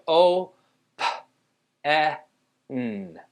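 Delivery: "O, P, E, N" as isolated sounds; long O, puffed P, short eh, hummed N, with pauses; sounded out. Shown as a demonstration. Teacher separates open into sounds, sounded out. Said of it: "we wanna separate this".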